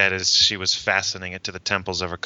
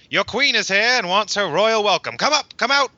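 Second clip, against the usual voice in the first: high, hammed-up Australian holler; calling out; 12 semitones higher, 3 dB louder than usual. A narrator's reading. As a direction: call them out in a put-on Australian voice. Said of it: "He called as he leapt down".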